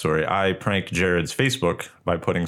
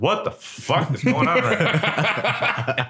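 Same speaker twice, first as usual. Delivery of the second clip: slow, high, stunned; shocked; 10 semitones higher, much slower than usual. Retold or imitated. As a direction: say it like you can't believe it. Retold shocked reaction.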